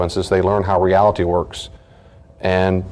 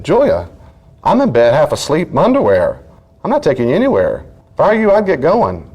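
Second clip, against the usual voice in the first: firm refusal; 7 semitones higher than usual. Retold or imitated. Father refusing daughter firmly.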